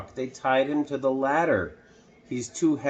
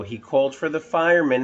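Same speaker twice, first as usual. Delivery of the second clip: ordinary delivery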